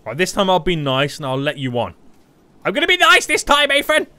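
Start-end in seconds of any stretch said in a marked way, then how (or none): 2.7-4.1 high, loud playful yelling at a friend; teasing shout. Real, not acted. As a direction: yell at Ethan in mock alarm.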